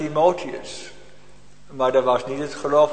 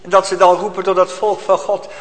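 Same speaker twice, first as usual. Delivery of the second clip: impassioned preaching, swelling and ebbing; fervent sermon; 3 semitones higher, 4 dB louder than usual. Acted. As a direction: preach it with urgency. Preaching with rising force. (vurige preek)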